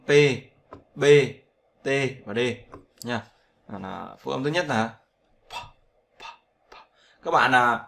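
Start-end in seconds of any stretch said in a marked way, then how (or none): 5.5-6.8 P said as a puff of air, no voice from the throat; just let air out on "p"; breathy English P. Shown as a demonstration. Teacher demonstrating a breath-only "p" to students. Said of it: "đặt tay vào trước mồm này và thấy gió ra".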